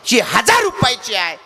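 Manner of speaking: high-pitched, emphatic offer; father upping the offer. Retold or imitated. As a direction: push the price up high, like a bigger offer. Father offers costlier toys.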